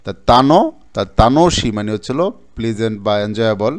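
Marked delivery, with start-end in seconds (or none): 0.0-0.6 teacher modelling তানো aloud; pronunciation demo. Shown as a demonstration. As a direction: spell out তানো clearly for the class. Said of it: "এটা উচ্চারণ হলো তানো".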